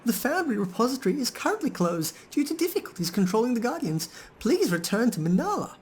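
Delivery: very high, sing-song machine announcement; golem's second voice. Acted. Player voicing the golem's glitchy second voice. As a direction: pitch it way up like a looping recorded notice. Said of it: "The golem reverts to its second voice".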